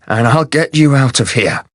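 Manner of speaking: ordinary delivery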